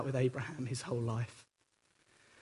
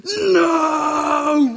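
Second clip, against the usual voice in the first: big high anguished shout; hammed-up dramatic cry of no; Hollywood anguish; 11 semitones higher, 9 dB louder than usual. Abraham in a Hollywood version refusing God, hammed-up shout. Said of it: "a Hollywood moment where he goes".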